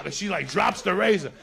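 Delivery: usual speaking voice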